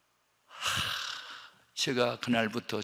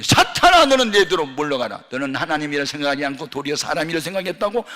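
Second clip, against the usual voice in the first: Jesus rebuking; 9 dB louder than usual. Retold or imitated. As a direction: banish Satan with a thundering command. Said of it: "책망을 했는데". (베드로를 꾸짖는 예수님의 크고 높은 호령)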